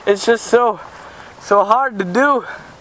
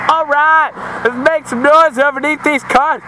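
calling out; 7 semitones higher than usual. Real, not acted. Calling out over the cars.

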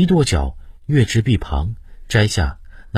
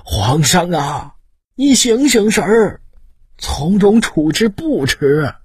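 much higher and louder, slow, crying out; wailing plea; 12 semitones higher, 4 dB louder, slower than usual. A narrator's reading. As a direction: weep and plead with the emperor to calm down. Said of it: "大哭道".

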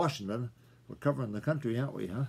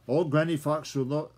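plain reading voice

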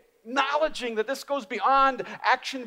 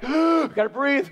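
mock gasp; 3 semitones higher, 5 dB louder than usual. Mock forgetting to breathe.

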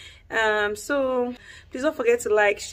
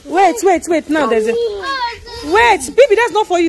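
raised, urgent voice; urgent interruption; 7 semitones higher, 5 dB louder, slower than usual. Real, not acted. Stops child taking another's gift.

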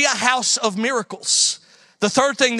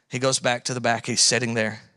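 ordinary delivery

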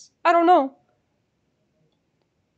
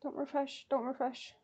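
very soft, under the breath; hushed self-reminder; 15 dB quieter than usual. Real, not acted. Fan resisting the refresh button, hushed self-reminder. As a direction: murmur a warning to yourself.

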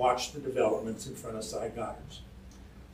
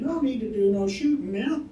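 high-pitched urgent plea, raised voice; cried out; 10 semitones higher, 5 dB louder than usual. A narrator's reading. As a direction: call out high to break up a gunfight. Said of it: "cried Owens as he ran up".

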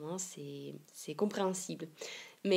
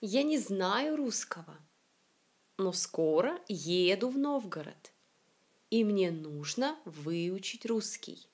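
careful phrase-by-phrase reading, first syllables of "знаю", "русского", "скоро" stressed; teacher's model reading, "нужно" and "русский" stressed on the first syllable. Shown as a demonstration. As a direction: lean on the first syllable of "Новгород" and "выучить", letting the rest fall. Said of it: "toutes les syllabes accentuées sont les premières syllabes".

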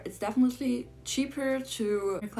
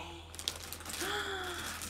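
high, soft awed gasp; sharp breath of awe; 10 semitones higher, 11 dB quieter than usual. Real, not acted. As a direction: gasp quietly in wonder. Sees the horse.

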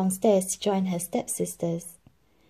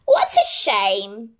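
slow, louder sneer; sneering stepmother; 2 semitones higher, 6 dB louder, slower than usual. A narrator's reading. Stepmother sneers at Cinderella, slow louder. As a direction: sneer with fake pity. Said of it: "sneered the stepmother".

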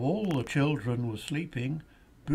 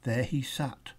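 usual speaking voice

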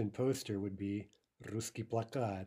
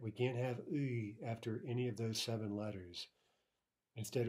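usual speaking voice

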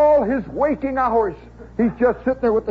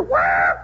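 startled yell. Retold or imitated. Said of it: "And you hear".

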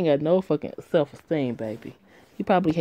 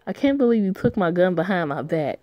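ordinary delivery

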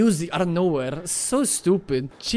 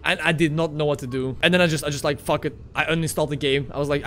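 usual speaking voice

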